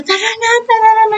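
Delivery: high, fast excited call hurrying someone along; retold shout. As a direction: yell at your sister to hurry up, high and quick. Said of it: "sumisigaw talaga siya".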